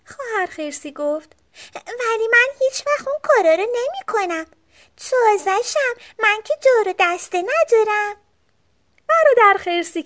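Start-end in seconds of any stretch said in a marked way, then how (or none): 1.8-8.1 put-on high little-girl bear voice; higher childlike storybook voice; sister bear. A narrator's reading. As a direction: pipe up as a young sister bear.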